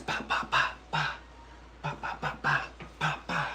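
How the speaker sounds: soft breathy puffs, pa pa pa; pad strike sounds. Retold or imitated. Imitating his own punches on the pads, soft puffed strikes. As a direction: puff out each punch like hitting pads.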